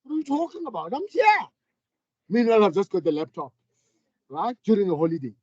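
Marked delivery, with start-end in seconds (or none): 0.0-1.5 indignant protest. Retold or imitated. Retold defensive reply to wife.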